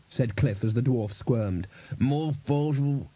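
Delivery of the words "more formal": slow gagged mumbling; muffled by hand. A narrator's reading. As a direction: protest through a hand over the mouth. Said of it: "clamped his huge hand over Glod's mouth".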